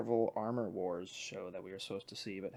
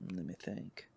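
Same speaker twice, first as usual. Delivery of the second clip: usual speaking voice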